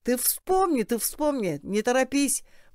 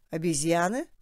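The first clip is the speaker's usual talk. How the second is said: «Обезьяны?» asked with a rising, doubtful question tone; puzzled question; 2 semitones lower than usual. Acted. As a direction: repeat «Обезьяны?» as if you can't believe it. The second man questions "monkeys. (недоумённый вопрос с подъёмом на «Обезьяны?»)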